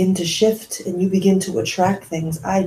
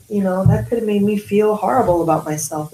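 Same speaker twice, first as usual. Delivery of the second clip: ordinary delivery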